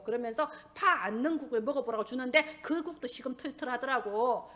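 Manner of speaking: usual speaking voice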